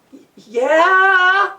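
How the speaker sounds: high, stammered, wavering reply; scared. Acted. Walter thirty feet up answering Jonathan, scared stammer. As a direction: stammer it upward as a nervous question. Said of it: "he was really scared".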